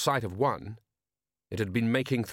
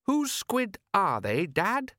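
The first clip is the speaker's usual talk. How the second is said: high, slow innocent question; puzzled son, high-pitched; young son; 10 semitones higher, slower than usual. Acted. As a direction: ask Dad in a boy's pitched-up voice. Son questioning father's claim.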